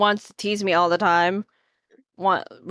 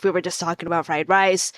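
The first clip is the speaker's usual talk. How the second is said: lower, faster repeat; low quick cover-up; 5 semitones lower, faster than usual. Real, not acted.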